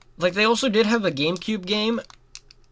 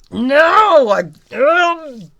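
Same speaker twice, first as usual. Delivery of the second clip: loud, higher cry of dismay; frustrated yell; 8 semitones higher, 7 dB louder, much slower than usual. Real, not acted. Gamer yelling at the game. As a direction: shout at the screen.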